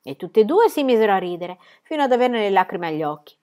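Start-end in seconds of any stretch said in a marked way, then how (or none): none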